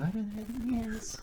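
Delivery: put-on high, soft girly voice; like a chick. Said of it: "he said it like a chick".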